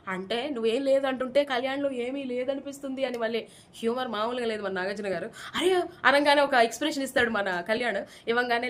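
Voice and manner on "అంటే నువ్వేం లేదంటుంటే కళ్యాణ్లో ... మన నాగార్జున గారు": normal talking voice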